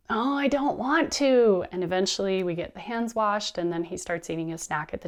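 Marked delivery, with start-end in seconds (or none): 0.1-1.6 slow, high, drawn-out mock whine; whining child. Child resisting parent over washing hands, drawn-out whine. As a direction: imitate a reluctant kid dragging out words.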